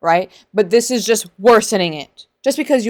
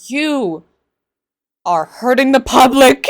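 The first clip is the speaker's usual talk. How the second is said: usual speaking voice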